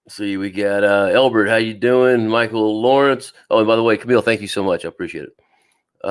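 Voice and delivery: ordinary talk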